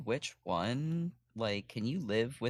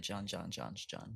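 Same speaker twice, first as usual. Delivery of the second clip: softly repeated name; 2 semitones lower, 8 dB quieter, slower than usual. Shown as a demonstration. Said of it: "it's called dedication to John".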